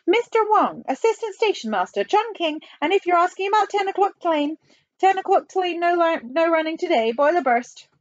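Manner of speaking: put-on high Chinese-accented phone voice; Chinese station master. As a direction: rattle off the notice in a high caricatured voice. Station master speaks in character.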